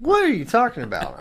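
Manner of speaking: high, baffled protest; incredulous. Real, not acted. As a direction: challenge a ridiculous pick. Challenges co-host's surprising pick.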